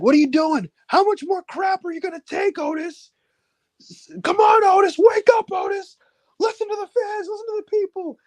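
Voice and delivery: high, pleading mock rally cry; rally him like a desperate friend; impassioned plea. Sami urging Otis to wake up, high impassioned plea. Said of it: "he's like".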